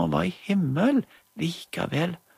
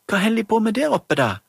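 higher, louder, fast eager question; curious child; 8 semitones higher, 6 dB louder, faster than usual. Acted. Magda asks about heaven, eager and quick. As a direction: ask eagerly. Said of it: "ganske så interesserte".

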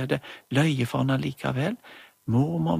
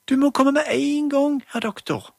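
much higher, louder pleading mum; urgent mother; 12 semitones higher, 5 dB louder than usual. Acted. Mother begs doctor to come, high and urgent. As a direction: urge the doctor to hurry. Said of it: "Det var Julie som snakket for mammen".

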